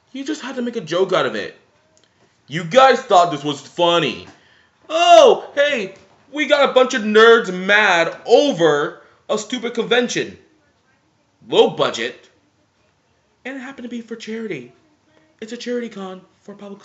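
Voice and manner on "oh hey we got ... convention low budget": higher, louder mock-gleeful voice; mock smug company. Vlogger mocks the company laughing at nerds, gleeful.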